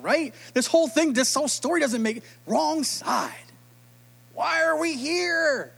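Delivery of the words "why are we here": slow mock complaint. Judas line echoed slowly.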